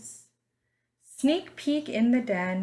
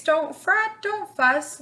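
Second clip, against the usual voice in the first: a lightly higher little mouse voice; squeaky mouse; 9 semitones higher, 7 dB louder than usual. Acted. Mouse comforting the crying bear, lightly squeaky voice. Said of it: "the mouse squeaks".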